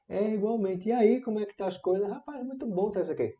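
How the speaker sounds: easygoing small talk; friendly reply. Acted. Returning a new acquaintance's greeting, friendly.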